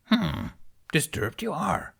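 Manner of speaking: high, lightly voiced little old Jedi master, hum then musing; a hint of an ancient, wise little creature; Yoda. A narrator's reading. Yoda to Ben in his apartment, lightly voiced wise old master. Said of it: "observed the old Grandmaster".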